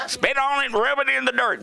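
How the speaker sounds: high, tough mom impression; mock mom brushing off a skinned knee; mom's brusque voice. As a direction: bark it like a no-nonsense mom. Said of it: "why she talked like that".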